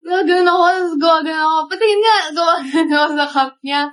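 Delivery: ordinary talk, nothing marked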